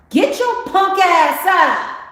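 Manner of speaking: high, loud, slow bellow at himself; loving angry yell. Retold or imitated. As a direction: roar it at yourself with love and anger. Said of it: "with all the love and the anger".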